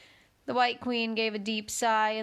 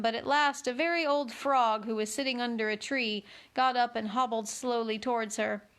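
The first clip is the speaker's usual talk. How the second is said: ordinary delivery, nothing marked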